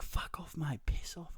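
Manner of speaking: held-back mock yell at the cat; strained, half-voiced imitation of yelling; retold yell. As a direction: re-enact yelling, without full volume. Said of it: "I've yelled at this cat".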